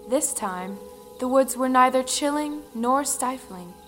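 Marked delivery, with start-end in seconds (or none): none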